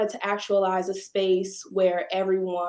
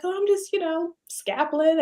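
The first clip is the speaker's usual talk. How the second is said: higher, offhand imitation of the painter; lightly voiced artist, tossed off and higher; casual artist; 9 semitones higher than usual. Painter answering the owner's hello, offhand and higher. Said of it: "And she was like".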